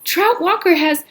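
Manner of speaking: high, loud urgent blurt; panicked. Acted. Catherine panicked explanation.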